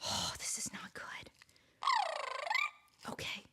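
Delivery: hushed whisper to herself; tense whisper over the noisy toy. Real, not acted. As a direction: whisper it so nobody hears. Whispering while fiddling toy.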